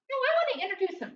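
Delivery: high, quick, insistent squeak; squeaky mouse butting in; Minnie Mouse's regular voice. Acted. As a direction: protest fast in a high cartoon voice. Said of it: "this is my regular voice".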